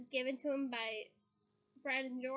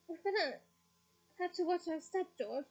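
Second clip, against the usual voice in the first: mock trembling; 5 semitones higher than usual. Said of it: "pretending to tremble".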